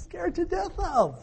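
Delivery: hammed-up frightened squeal; mock terror. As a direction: pretend to be scared stiff.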